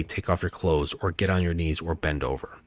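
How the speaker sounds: low, soft, matter-of-fact orders; relaxed bedroom commands; calm short commands. Shown as a demonstration. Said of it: "to say".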